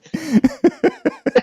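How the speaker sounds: real laugh breaking up high. Real, not acted. Host cracking up at the guest's phrase, high laugh. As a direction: giggle climbing up.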